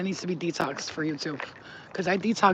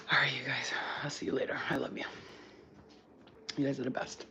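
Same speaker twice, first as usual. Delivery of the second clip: whisper; 4 semitones lower, 8 dB quieter than usual. Real, not acted. Whispered goodbye to viewers.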